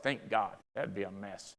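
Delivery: low, soft deadpan reply; dry aside. Retold or imitated. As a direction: mutter it under your breath. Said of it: "I was like".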